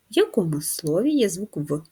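usual speaking voice

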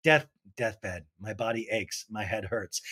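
low, soft, feeble sick moan; mock sick Katie. Host imitating Katie texting she is too sick to come, low and feeble. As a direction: play it weak and dying from bed. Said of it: "And Katie's like".

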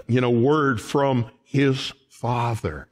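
ordinary talk

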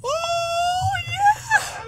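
shrill scream of relief; being chased. Real, not acted. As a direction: shriek it out very high. Escapes the chasing monster.

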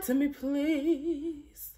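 ordinary talk

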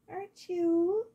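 high cooing pet voice; talking to a pet. Real, not acted. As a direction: coo at the kitty. Cooing at the cat.

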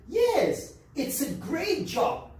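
high, ringing yes, then slow; a bright, proud answer about the job; proud reply. Shown as a demonstration. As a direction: show off the job gladly. Teacher voices the student reply.

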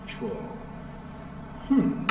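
normal talking voice

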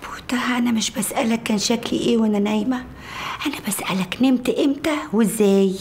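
whispering. Acted. Whispered bedroom talk after waking.

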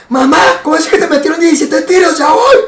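alarma fingida al teléfono, aguda y exagerada; falsa emergencia. Pretend. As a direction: finge un susto por la mamá, agudo. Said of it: "hace show mediático". (actuado, agudo y alarmado)

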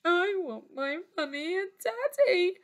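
put-on high, tearful little-girl whimper; forlorn, high-pitched sniffly voice; sniffling. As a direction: whimper it like a lost child. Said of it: "sniffed the little princess".

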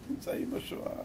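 whispered repeat for weight; very soft, breath-only emphasis; hushed awe. Real, not acted. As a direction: drop to a grave whisper. Solemn repeat for the audience.

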